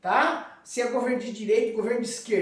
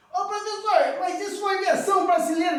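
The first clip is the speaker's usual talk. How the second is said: high-pitched pupil butting in; eager student; 9 semitones higher, 4 dB louder than usual. Acted. Voices a student asking.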